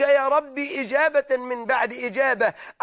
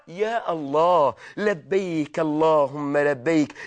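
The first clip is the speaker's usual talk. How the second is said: reverent low plea; 8 semitones lower, slower than usual. Acted. الواعظ يلبي بخشوع.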